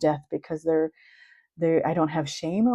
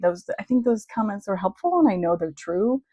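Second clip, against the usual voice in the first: ordinary delivery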